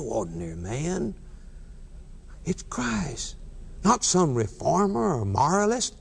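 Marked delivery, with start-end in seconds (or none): 2.4-3.4 soft reverent whisper. Acted. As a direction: whisper it as a holy secret. Preacher whispers the key line.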